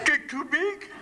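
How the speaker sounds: high, garbled talk through teeth too big; oversized false teeth. Acted. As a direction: squeak it past a mouthful of huge dentures. Mouth full of oversized false teeth.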